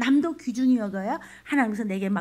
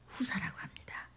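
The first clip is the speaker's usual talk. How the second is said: whispered prayer; 3 semitones lower, 18 dB quieter than usual. Real, not acted. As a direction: pray it under your breath. Whispered sermon passage.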